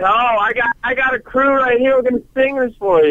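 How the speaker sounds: high-pitched, eager burst; fired up, pitched well up; excited rallying. Real, not acted. Caller announces his crew will sing for the host, excited.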